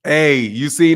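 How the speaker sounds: ordinary talk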